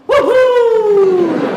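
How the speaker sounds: very high, loud falsetto whoop; Mario "woohoo". Acted. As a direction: let out a long, gleeful cartoon whoop. Mario cheer after getting game offer.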